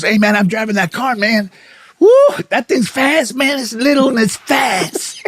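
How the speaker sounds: lightly voiced thrilled friend; excited friend. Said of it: "He goes".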